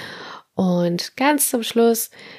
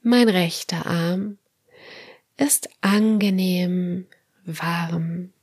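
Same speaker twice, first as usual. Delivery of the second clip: guided meditation; slower than usual. Acted. Leads autogenic training relaxation.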